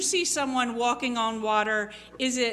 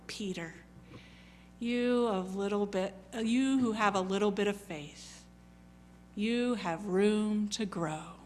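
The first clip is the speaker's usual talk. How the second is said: soft, lower tender coaxing; gentle parent; 3 semitones lower, 5 dB quieter than usual. Retold or imitated. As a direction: speak like a parent lifting a fallen child. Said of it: "like a parent who is helping their child".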